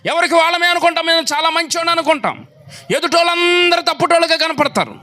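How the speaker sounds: high, driving sermon voice; impassioned pulpit delivery; fiery preaching. Acted. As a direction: rouse the congregation. Preaching at full force.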